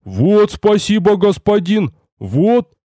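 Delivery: high, drawn-out grateful bellow; joyful bark. A narrator's reading. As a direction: thank him with hammed-up delight. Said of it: "обрадованно рявкнул Рикар".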